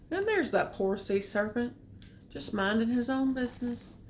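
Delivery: ordinary delivery, nothing marked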